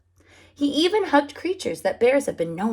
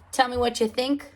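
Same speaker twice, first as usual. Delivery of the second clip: ordinary delivery, nothing marked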